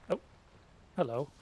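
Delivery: normal talking voice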